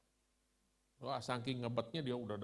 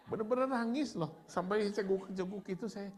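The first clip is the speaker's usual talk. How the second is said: laughing; 7 semitones higher, 5 dB louder than usual. Real, not acted. Laughing about sobbing hard.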